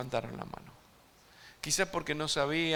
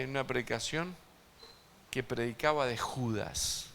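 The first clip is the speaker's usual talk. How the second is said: ordinary delivery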